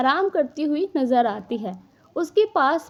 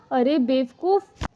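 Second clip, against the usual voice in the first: usual speaking voice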